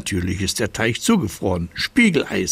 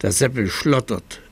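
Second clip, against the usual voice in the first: plain reading voice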